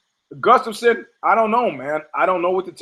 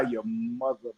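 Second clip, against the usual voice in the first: muttered insult; 7 semitones lower, 13 dB quieter, much faster than usual. Retold or imitated. Job's muttered insult to God.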